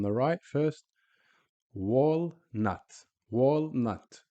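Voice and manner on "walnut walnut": "Walnut" split into wal, nut; stop on the L. Shown as a demonstration. Teacher showing sukoon in English, split syllables. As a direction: pause after the L in each "Walnut". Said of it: "we stop on the lam".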